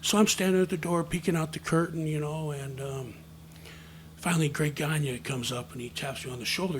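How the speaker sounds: ordinary talk, nothing marked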